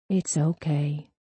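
slow, soft reassurance; hushed comfort. A narrator's reading. Mother stroking her crying daughter's hair, hushed comfort. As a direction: soothe her, softly and slowly. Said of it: "Kathy hushed, stroking Sarah's hair".

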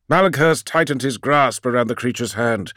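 plain reading voice

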